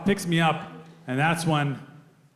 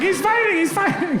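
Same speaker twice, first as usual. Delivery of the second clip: panicky cop; 14 semitones higher than usual. Retold or imitated. Panicking cop repeats himself.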